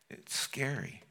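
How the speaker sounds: normal talking voice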